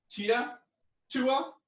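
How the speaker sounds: high shouted kiai pair; karate yells. Shown as a demonstration. Teacher leading the class through punch yells. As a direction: yell each punch crisply. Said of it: "One hand yell".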